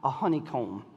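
low, soft, slow scripture reading. Acted. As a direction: read it softly, like honey. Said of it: "Proverbs sixteen twenty-four".

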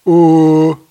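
far-off-natural dopey straight guy voice; dumb straight man. Retold or imitated. Man mimicking how girlfriends imitate him, mock dopey straight-guy voice. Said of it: "has done this impression of me".

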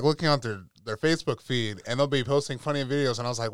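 ordinary talk, nothing marked

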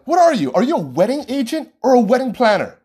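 high, pressing challenge; demanding question. Retold or imitated. Asking the planner what she is, high and pressing. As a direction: demand an answer from them. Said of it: "I asked them".

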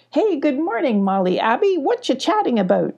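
high, singsong storybook hello; lightly acted chirpy character voice; cheery greeting. A narrator's reading. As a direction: greet friends breezily, pitch swooping up. Sparkle greets friends, fishing for information.

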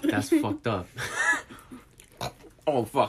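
coughing through a choke on popcorn; choked laugh with popcorn stuck; choking on popcorn. Real, not acted. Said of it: "you just choked on popcorn".

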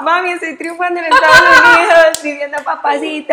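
loud and high, laughing through the words. Real, not acted. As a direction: burst out laughing while telling mom. Laughing at her own success story.

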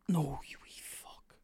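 a hissed whisper of disgust; whispered curse. Real, not acted. Gamer whispering at the opponent who scores, whispered curse. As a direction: curse under your breath at the screen.

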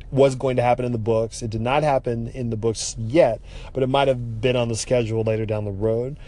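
ordinary delivery

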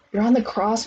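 ordinary delivery, nothing marked

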